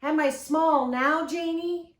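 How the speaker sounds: high, loud, slow call; boy calling out across a distance; calling from afar. A narrator's reading. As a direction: holler it down a long beach. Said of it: "he called".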